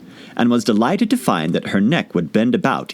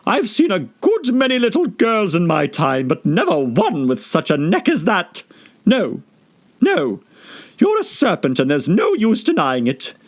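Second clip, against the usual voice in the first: very high sneering bird; contemptuous pigeon; 10 semitones higher than usual. Acted. Pigeon scorning Alice. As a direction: scoff at her.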